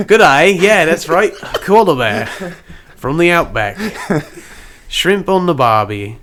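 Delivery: loud, broad mock-Aussie koala; hammed-up Australian accent. Koala bear from the outback, loud hammed-up Australian accent. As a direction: belt out cartoon Australian catchphrases. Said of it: "You lost your Australian accent".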